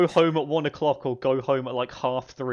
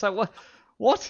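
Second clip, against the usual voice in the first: high incredulous what; baffled outrage; 7 semitones higher than usual. Real, not acted. Outraged at dress code double standard.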